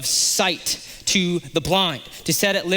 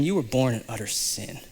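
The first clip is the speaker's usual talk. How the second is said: scornful leaders; 6 semitones lower, 7 dB quieter than usual. Acted. Leaders cast man out scornfully.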